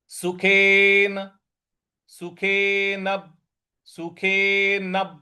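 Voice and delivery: सुखेन drawn out slowly, syllable by syllable; slow pronunciation demo. Shown as a demonstration. Teacher drills the word slowly, careful demo. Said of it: "वहां हमको आघात देना है".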